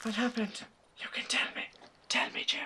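hushed whisper. Pretend. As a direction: whisper it secretly. Whispering to game character.

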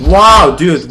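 usual speaking voice

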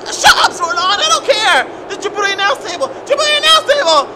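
high, loud angry screaming; furious shout. Acted. Commentator yelling at Zordon to shut up, furious shout. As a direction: scream him down in rage.

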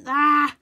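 short loud "ah" outburst; sudden loud yell. Real, not acted. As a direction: cut yourself off with a loud cry. Sudden outburst mid-sentence.

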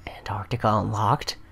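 soft whispered announcement. Real, not acted. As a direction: whisper the unlock. Reads unlock notice aloud.

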